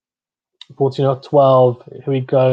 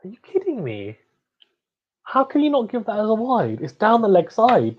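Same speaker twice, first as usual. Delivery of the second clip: exasperated disbelief; 7 semitones higher than usual. Real, not acted. Arguing with the umpire's call.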